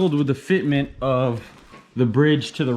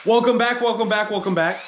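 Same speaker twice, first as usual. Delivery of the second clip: excited greeting; 8 semitones higher than usual. Real, not acted. Vlog intro greeting.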